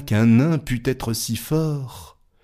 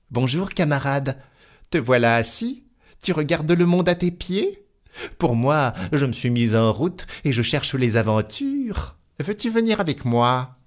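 higher, lively tailor voice; cheeky little tailor; 6 semitones higher than usual. Acted. Tailor hailing the giant, bold and high-pitched. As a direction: greet the giant jauntily.

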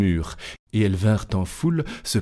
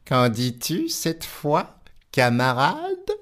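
slow, higher teasing; taunting tailor; 6 semitones higher, slower than usual. Acted. Tailor teasing the giant, slow and higher. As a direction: taunt him sweetly.

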